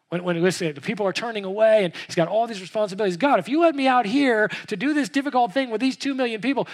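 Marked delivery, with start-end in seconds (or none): 3.2-6.7 desperate cry. Acted. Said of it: "Moses did, did this, remember?".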